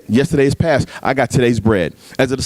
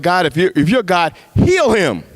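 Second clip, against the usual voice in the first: angry plea; 6 semitones higher, slower than usual. Retold or imitated. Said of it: "I got mad".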